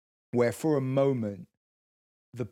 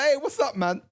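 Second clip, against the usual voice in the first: breezy greeting; 12 semitones higher than usual. Retold or imitated. Friendly greeting to a stranger.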